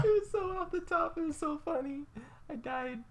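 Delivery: high, breathless laughing talk. Real, not acted. Recalling the joke to the host, laughing high. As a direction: still cracking up while talking.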